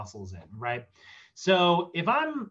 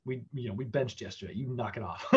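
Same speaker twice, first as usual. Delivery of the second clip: grumpy pec voice; 3 semitones lower, 7 dB quieter than usual. Acted. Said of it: "my pec's like".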